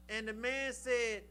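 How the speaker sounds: ordinary talk, nothing marked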